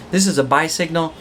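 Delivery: ordinary talk, nothing marked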